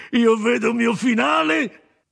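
high, loud, slow mocking shout; loud sardonic cry. A narrator's reading. Leclerc defies the dog that will hang him, loud and sardonic. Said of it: "facendo una rumorosa risata sardonica".

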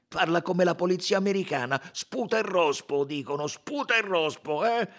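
loud, high and scornful; riled Italian witness; Italian suspect, indignant. A narrator's reading.

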